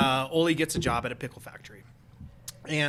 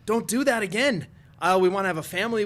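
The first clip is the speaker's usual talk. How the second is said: higher, louder scolding wife; pleading wife; 5 semitones higher, 5 dB louder than usual. Acted. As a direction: plead with him as an alarmed wife. Wife scolds husband in joke.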